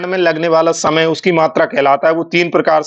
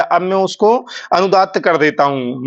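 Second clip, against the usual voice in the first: ordinary talk, nothing marked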